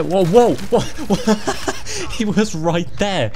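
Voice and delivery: high startled whoas breaking into laughter; startled laughing. Real, not acted. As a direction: yelp in shock, laughing through it. Startled by game jump scare, laughing.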